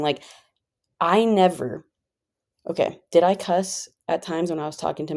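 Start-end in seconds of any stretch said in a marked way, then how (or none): none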